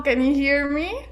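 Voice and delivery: usual speaking voice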